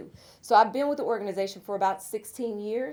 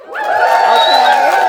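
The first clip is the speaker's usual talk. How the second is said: much higher and louder, long rising okay; excited squeal; 16 dB louder than usual. Real, not acted. Host thrilled as a sponsor's table cheers, squealing okay.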